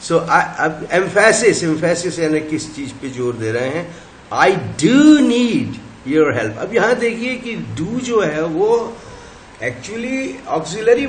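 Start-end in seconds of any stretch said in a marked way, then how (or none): 4.3-6.6 "do" emphasised, slow; stress on do. Shown as a demonstration. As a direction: lean on "do" in "I do need your help". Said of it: "जब do पे हमने shift दे दिया". ("do" पर ज़ोर)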